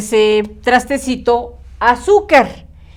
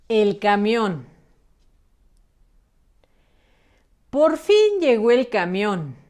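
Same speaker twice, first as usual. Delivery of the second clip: softer, measured story reading with long pauses; reading to children; 6 dB quieter than usual. A narrator's reading. Teacher reading the bus story to pupils, soft and measured. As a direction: read it softly, sentence by sentence.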